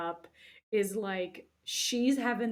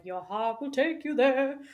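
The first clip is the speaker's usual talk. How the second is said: higher, louder put-on wise old voice; mystical Rafiki; 6 semitones higher, 10 dB louder than usual. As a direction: proclaim it like a wise old mentor.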